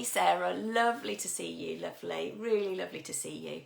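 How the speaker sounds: normal talking voice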